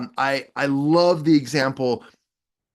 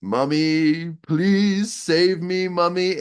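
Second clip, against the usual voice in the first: "Mummy" said slow and pleading, a touch higher; mock begging; 3 semitones higher, slower than usual. Commander begging Rocket Man to save him, hammed-up plea. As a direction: whimper it like a scared child. Commander begs after "Louder.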